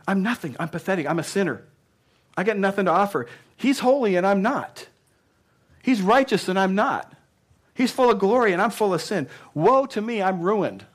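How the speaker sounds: high, loud, pleading cry of unworthiness; anguished confession. Acted. Pastor voicing Isaiah's despair to the church, high and loud. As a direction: pour out the despair of a ruined man.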